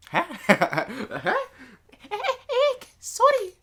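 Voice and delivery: high, loud squeaky apology; flustered, very high-pitched yelps and a loud sorry; startled character. Acted. Reader voices startled girl's apology, high flustered squeal.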